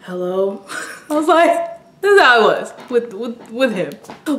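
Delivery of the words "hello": put-on boyfriend's hello. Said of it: "and says".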